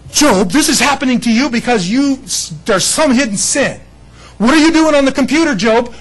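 accusing friends. Acted. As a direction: jab at Job like a self-righteous buddy. Friends accusing Job, acted.

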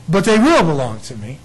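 ordinary talk

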